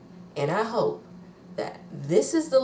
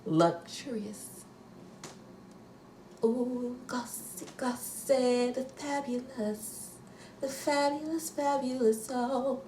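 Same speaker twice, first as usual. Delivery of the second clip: breathy swoon; 6 semitones higher, 8 dB quieter, slower than usual. Pretend. Swooning over the luxury plane.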